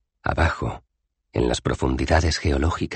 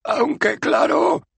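very high, slow, squeaky retreat; nervous backpedal; 4 dB louder, much slower than usual. Acted. Citizen with a sword at his neck, squeaking nervously. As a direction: swallow hard and give in.

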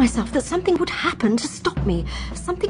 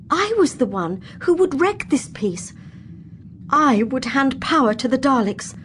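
plain reading voice

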